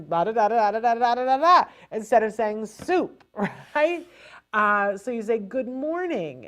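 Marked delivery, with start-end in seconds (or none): 0.0-1.6 loud rattled-off gibberish, rising in pitch; hammered nonstop da-da syllables; mock foreign babble. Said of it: "We're that Russian woman going". 5.4-6.5 high then falling, drawn-out greeting; slow, specific greeting. Shown as a demonstration. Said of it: "and pause".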